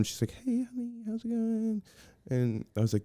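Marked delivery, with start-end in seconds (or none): 0.4-1.8 imitating a sweet mom, much higher and softer. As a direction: coo it like a mother greeting her kid. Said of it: "And she's like a mom".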